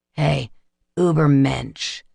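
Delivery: slow, mocking hiss close to the ear; vampire taunting the skinhead, hissing. A narrator's reading. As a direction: sneer the word out slowly. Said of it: "Tegan hissed".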